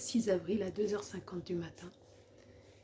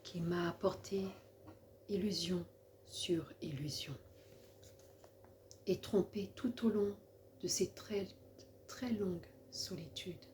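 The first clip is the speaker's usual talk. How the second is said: solemn, measured poem reading with long pauses; poem recitation; 2 semitones lower, 4 dB quieter than usual. Acted. Woman reading her own poem for the Lord, reciting solemnly. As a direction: recite it line by line, letting each phrase settle.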